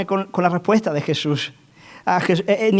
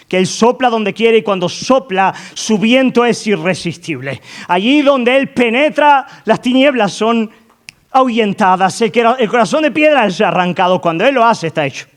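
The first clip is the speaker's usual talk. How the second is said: fervent preaching; 5 semitones higher, 5 dB louder than usual. Acted. Preacher proclaiming the Spirit's power to the church. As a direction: preach it with growing fire. Preaching builds to full force.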